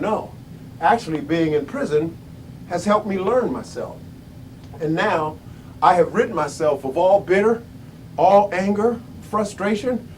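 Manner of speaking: low, soft, measured answer; calm imitated reply. Prisoner Mandela answering interviewers without bitterness, calm and low. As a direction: answer gently, well below full voice.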